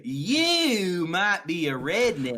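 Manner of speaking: very slow, stretched Southern drawl. Acted. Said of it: "pseudo Southern drawl kind of thing".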